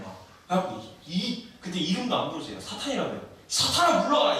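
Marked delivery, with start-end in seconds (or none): 3.5-4.4 high, loud acted scolding; stern rebuke. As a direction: drive him off with a sharp command. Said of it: "이러고 막 땡을 하고 털렸단 말이에요".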